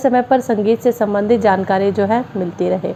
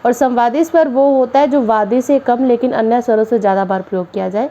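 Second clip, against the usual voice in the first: ordinary delivery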